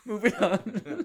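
slow, laughing moving on. Real, not acted. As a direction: laugh off the question. Dodging question, laughing.

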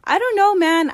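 high, loud quoted exclamation; imitating someone baffled and worked up; animated reenactment. Acts out birth mom's funny answer.

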